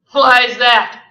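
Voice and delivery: loud scoffing question; mocking. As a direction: scoff at him.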